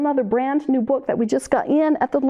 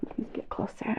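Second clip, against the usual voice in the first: breathy whispered aside; whispering; 13 dB quieter, faster than usual. Real, not acted. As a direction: whisper it to yourself. Unvoiced aside at the mic.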